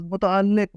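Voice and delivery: متعلق ending in "liq", doubled l; zer under laam. Shown as a demonstration. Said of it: "درست لفظ". (متعلق میں لام کے نیچے زیر)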